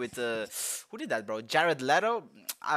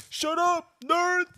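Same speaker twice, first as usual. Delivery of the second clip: annoyed neighbor; 5 dB louder, much slower than usual. Retold or imitated. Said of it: "My neighbor's like".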